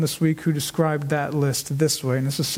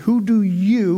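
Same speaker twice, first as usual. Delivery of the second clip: "you" in "Who do you" leaned on, louder; emphatic you, pressing the disciples; stress on YOU; 2 semitones higher, 4 dB louder, slower than usual. Shown as a demonstration. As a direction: hit the word you hard. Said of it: "with an emphatic U in the original language".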